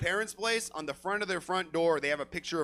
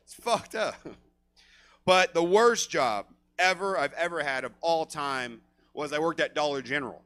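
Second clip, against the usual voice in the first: usual speaking voice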